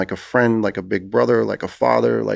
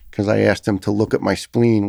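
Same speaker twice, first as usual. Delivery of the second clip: usual speaking voice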